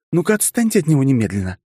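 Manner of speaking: high sharp angry order; furious girlfriend scolding. Acted. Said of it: "злющая Пакля".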